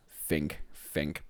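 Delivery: soft, low think think; urging yourself under your breath; quiet self-prodding; muttered to self. Real, not acted.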